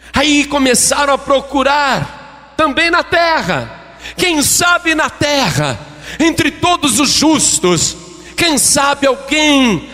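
high, shouted preaching, easing slightly at the end; impassioned preaching. Acted. Preacher telling of the search on earth, impassioned shouting. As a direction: plead it out at full force.

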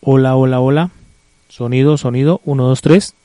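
very loud, low-pitched mic check; prueba de micrófono. Real, not acted. Probando el micrófono. (prueba de sonido en voz muy alta y grave)

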